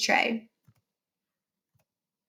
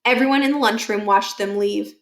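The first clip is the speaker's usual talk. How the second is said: ordinary delivery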